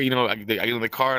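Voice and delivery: ordinary talk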